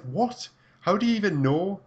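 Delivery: high-pitched incredulous comeback; retold surprise. Telling how a stranger guessed the accent, surprised. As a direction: blurt it out high, as if caught out. Retelling startled reply to stranger.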